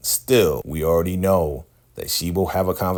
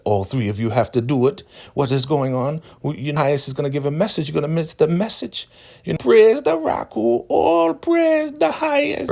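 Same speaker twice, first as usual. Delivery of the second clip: elder rising into shrieking praise; 9 semitones higher than usual. Retold or imitated. Elder urging the crew to the message, building to squealing praise. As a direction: build to a shrill holy cry.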